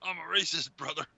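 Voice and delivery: high put-on wrestler voice, brother tacked on; wrestler promo voice. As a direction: parody of a pro wrestler cutting a promo. Copies wrestler voice.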